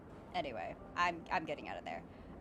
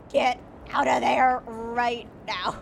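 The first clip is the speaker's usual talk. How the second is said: slow, loud, higher order; loud command; 6 semitones higher, 11 dB louder, slower than usual. Real, not acted. Streamer ordering her friend off the character, slow loud command. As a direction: bark it out slowly. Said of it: "I'm like".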